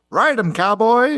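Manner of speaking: high, drawn-out mock cowboy holler; imitated radio yell. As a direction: holler it slowly like a rodeo rider. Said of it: "yells out over the radio".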